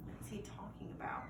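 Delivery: soft whispered aside. Acted. Narrator sharing a secret aside with listeners, whispered. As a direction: lean in and whisper it.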